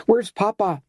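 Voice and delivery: high, anxious cry of a question; frightened cry. A narrator's reading. Esmeralda begs Garnish to say where her father is, frightened cry. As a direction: plead to know where he is. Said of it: "cried Esmeralda".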